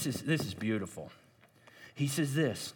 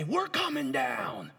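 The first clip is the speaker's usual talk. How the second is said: mock shout; 8 semitones higher, slower than usual. Quoting Philistines' shout.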